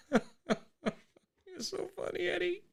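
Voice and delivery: squeaky high voice, crying with laughter; helpless laughing tears. Real, not acted. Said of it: "got into that ugly cry thing again".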